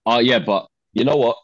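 ordinary talk, nothing marked

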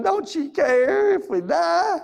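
high, slow, frantic cry of the disciples; panicked disciples. Acted. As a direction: plead in terror over the storm. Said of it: "his disciples are freaking out".

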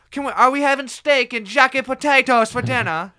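put-on British accent. Mimics Brit using new word.

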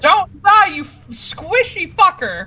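high, loud shout across the room; calling from far away. Acted. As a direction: bellow it across the battlefield. Yelling to ally in battle.

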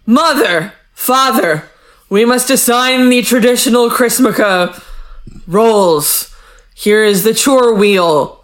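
hammed-up formal summons, pausing between phrases; mock proclamation. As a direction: declaim it to the parents in stages. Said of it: "So he's like".

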